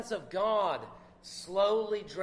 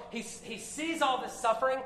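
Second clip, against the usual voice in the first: ordinary talk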